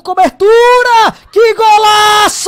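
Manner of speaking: slow, loud shout of golaço; explosive goal commentary. Real, not acted. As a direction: roar it as the shot drops in. Goal scored, live call.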